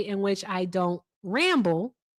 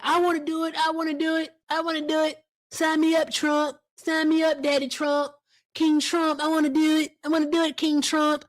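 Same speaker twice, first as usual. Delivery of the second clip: eager whiny crowd voice; 6 semitones higher than usual. Retold or imitated. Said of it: "So many silly pants are gonna be like".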